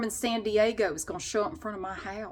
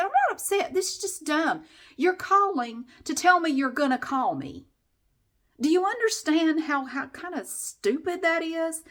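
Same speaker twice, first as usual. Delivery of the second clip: indignant retort; 6 semitones higher than usual. Retold or imitated. Annoyed customer snapping back at the caller on the phone. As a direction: pitch jumps high on not upset.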